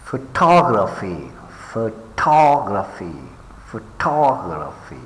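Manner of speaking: photography with TOG punched, then falling; pho-TOG-raphy, leaning on TOG; stress on second syllable. Shown as a demonstration. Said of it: "pay attention to the stress syllables".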